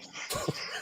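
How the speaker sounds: soft huff-and-puff of breath; imitated huffing. Imitating the scrawny guy on the treadmill, huffing. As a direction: mimic puffing through a plastic flap. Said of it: "he's huffing and puffing".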